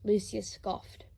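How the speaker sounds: ordinary delivery, nothing marked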